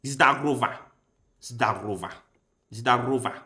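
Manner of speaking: ZDAROVA tried as zda-RO-va with rolled R; attempted Russian pronunciation. Shown as a demonstration. Learner trying out Russian hello, attempted Russian pronunciation. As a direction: sound out Zdarova carefully, one take at a time. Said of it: "So it's pronounced".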